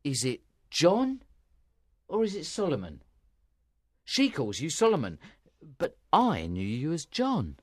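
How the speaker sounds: deliberate man's voice, puzzling aloud; pondering, with marked pauses; thoughtful. A narrator's reading. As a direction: work it out as you question him. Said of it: "he asked the man thoughtfully".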